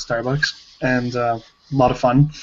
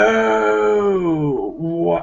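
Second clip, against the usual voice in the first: long, high drawn-out "oh" of disbelief; stunned reaction; 4 semitones higher than usual. Retold or imitated. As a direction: act out being dumbstruck, stretching the vowel. Acting out her stunned reaction in story.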